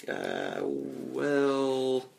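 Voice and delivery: long drawn-out hesitant filler; stall, stretching each word out; dragged, noncommittal hedging; awkward stalling. Acted. Acted sheepish stalling when questioned.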